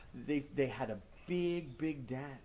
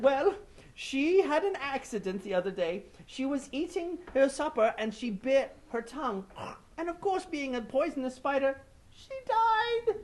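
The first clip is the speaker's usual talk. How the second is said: high mourning voice; 10 semitones higher than usual. Acted. Brother-in-law telling the death.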